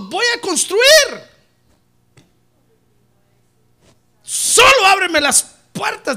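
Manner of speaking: high, loud, slow plea to God; young businessman's bold plea to the Lord; eager pleading prayer. Retold or imitated. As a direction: beg God to open the doors. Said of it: "le decía, "Señor".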